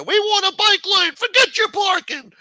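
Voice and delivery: high, very loud mocking screech; drawn-out, squawking mock shouts at top pitch; mock protest barking. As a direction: shriek it like a braying mob of council-meeting loudmouths. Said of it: "They fucking bark the loudest".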